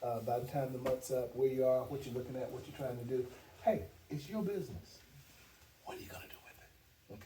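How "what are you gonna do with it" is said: breathy whispered challenge. Real, not acted. Challenging the salespeople about their business, whispered. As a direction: drop to a whisper and dare them.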